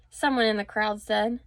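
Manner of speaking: ordinary delivery, nothing marked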